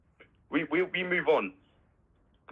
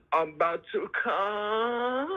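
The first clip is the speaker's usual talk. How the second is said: imitating porn star; 9 semitones higher, much slower than usual. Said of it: "He goes".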